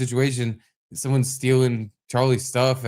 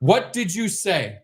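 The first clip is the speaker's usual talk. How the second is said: higher, slow, deliberate demand; indignant challenge; 6 semitones higher, slower than usual. Retold or imitated. Dad confronting the neighbor who cursed him, indignant. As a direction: stretch out the challenge word by word.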